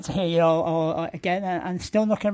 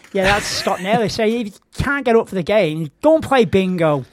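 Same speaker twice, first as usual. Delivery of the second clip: usual speaking voice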